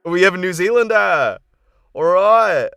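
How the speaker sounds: loud, high New Zealand announcement; New Zealand accent. Shown as a demonstration. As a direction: shout the result in an excited New Zealand accent. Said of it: "what voice do you guys want".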